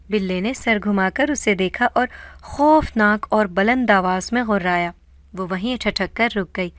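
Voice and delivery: plain reading voice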